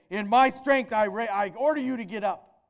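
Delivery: wrong way, loud boast; boastful command. Shown as a demonstration. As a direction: bark it as if the power were your own. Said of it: "He doesn't do that".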